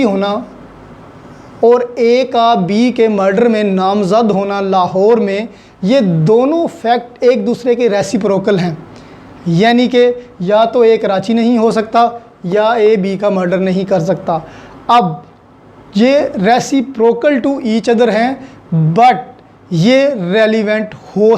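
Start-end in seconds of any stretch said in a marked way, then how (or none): none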